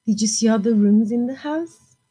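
usual speaking voice

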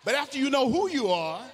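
full-force preaching. Acted. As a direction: preach it out to the rafters.

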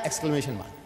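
ordinary talk

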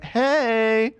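high-pitched, put-on hey; disguised greeting. Shown as a demonstration. Player replays mic greeting, high disguised voice. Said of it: "I was like".

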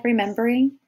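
ordinary delivery, nothing marked

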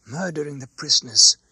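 usual speaking voice

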